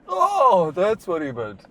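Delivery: high, slow-witted "oh" of sudden understanding; mock dawning realization. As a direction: play a dim realization clicking into place. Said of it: "it was like".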